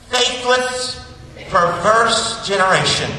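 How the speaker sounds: high, loud, slow denunciation; angry rebuke. Retold or imitated. Jesus rebuking the whole generation, angry. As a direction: hurl each word out in acted anger. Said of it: "He sounds impatient. He sounds angry".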